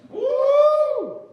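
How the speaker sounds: high, loud "woo"; celebratory whoop. Acted. Storyteller cheering his own idea, high excited whoop.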